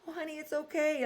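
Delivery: put-on high, soft comforting to a child; reassuring big sister. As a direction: soothe a sobbing little sister. Comforting crying little sister.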